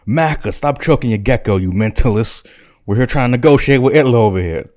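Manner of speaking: exasperated scolding. Acted. Chamberlain telling Macker to stop mid-negotiation, exasperated. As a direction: scold him across the table.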